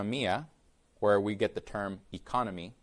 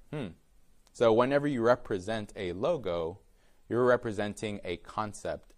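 usual speaking voice

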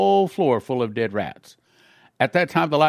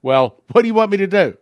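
frightened; 6 semitones higher, 7 dB louder than usual. Retold or imitated. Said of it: "he really started shaking".

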